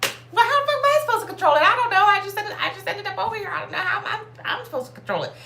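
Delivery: high, loud, fast exasperated protest; panicked high whine, half laughing; exasperated squeal. Retold or imitated. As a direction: squeal it out in frustrated disbelief. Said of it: "And I'm like".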